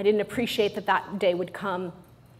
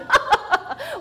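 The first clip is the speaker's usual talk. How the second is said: a real high cackle at herself; high burst of laughter; 4 dB louder than usual. Real, not acted. Speaker laughs at her younger self, high and real.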